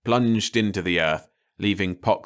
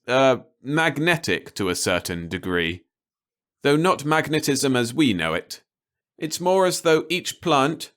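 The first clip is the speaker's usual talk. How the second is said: higher voice of a scientist addressing the world; scientist announcing; 4 semitones higher than usual. Acted. Scientist explains on TV.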